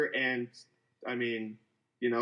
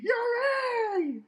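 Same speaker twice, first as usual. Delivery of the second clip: mock scream. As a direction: scream the name at the screen. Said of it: "screaming at the T V".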